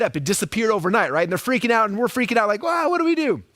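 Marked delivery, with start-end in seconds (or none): 2.6-3.4 high-pitched mock alarm; mock panic. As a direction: play the helpless marketer. Marketers panicking.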